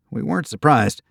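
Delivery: usual speaking voice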